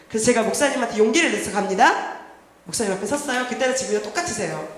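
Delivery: usual speaking voice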